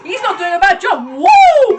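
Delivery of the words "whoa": loud, high startled cry; real shocked whoa. Real, not acted. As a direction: blurt it out in real surprise. Reacting to stunt on screen.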